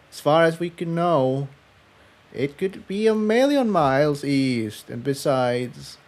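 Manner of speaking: uneasy and unsure; Tiptoe, worried. Acted. Tiptoe's voice, high and soft.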